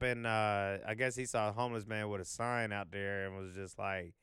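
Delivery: normal talking voice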